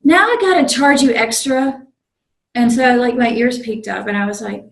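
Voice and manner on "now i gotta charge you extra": higher, louder gruff conductor scolding; berating conductor. Retold or imitated. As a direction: bark the fine at him like a bossy conductor. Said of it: "And he's like".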